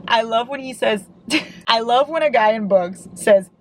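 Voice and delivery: usual speaking voice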